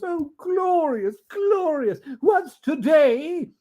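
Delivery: very high, slow, tearful rapture; rapturous Scrooge. Acted. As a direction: savour the glory. Scrooge at window.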